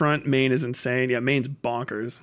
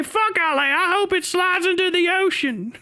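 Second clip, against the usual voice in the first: much higher dim desert local, proud of it. Retold or imitated. Hick answers in voice.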